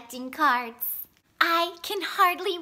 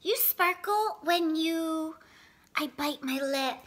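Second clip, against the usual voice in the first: plain reading voice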